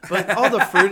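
laughing through a loud rant. Real, not acted. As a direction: crack up while ranting.

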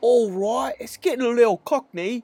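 wife in British voice; put-on British accent. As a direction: thank like a Londoner. Mock British voice continues.

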